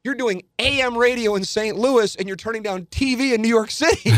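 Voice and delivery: mock angry agent. Agent yelling he'll never leave Saint Louis, high mock fury. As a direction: squeak it out in outraged disbelief. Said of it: "don't blame the agent for being mad".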